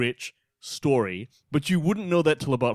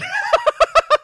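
high, loud burst of laughter; 8 dB louder than usual. Real, not acted. Cracking up after a cheeky line about Hollywood, loud laugh. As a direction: crack up at your own joke.

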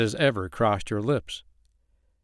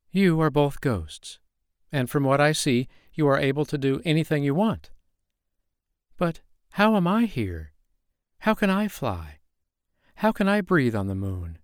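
wondering guest voice; 4 semitones higher than usual. Acted. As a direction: ask in awed disbelief.

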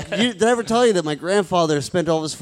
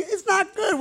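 very high little voice; squeaky meek voice; 13 semitones higher, 3 dB quieter than usual. Pretend. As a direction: squeak it out timidly. Repeat in squeaky voice.

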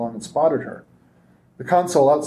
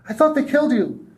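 relieved; 11 semitones higher than usual. Acted. Christine hugging rescuer.

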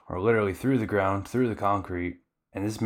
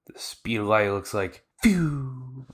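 ordinary talk, nothing marked

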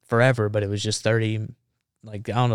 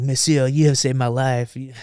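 gushing thanks in a put-on French accent; bad French accent; 3 semitones higher than usual. French reporter thanking the Marine who saved him, hammy French accent. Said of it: "it's a bad French accent".

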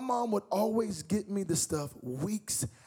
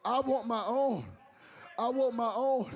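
preaching; 6 semitones higher, slower than usual. Acted. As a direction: drive it home from the pulpit. Preaching at full force.

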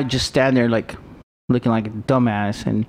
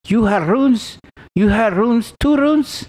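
higher, lightly mocking Russian-accented motel lady; 10 semitones higher, 3 dB louder, slower than usual. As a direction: imitate a Russian motel clerk asking about rooms. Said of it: "thick accent".

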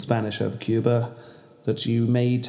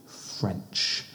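hushed; 5 semitones lower, 10 dB quieter than usual. Real, not acted. Tactful hushed word.

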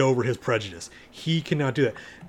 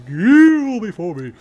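slow, booming, much higher villain command; Terrence Stamp voice; 9 semitones higher, 10 dB louder, slower than usual. Retold or imitated. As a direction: loom over them, slow and loud. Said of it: "when you hear that voice, you know that's Terrence Stamp".

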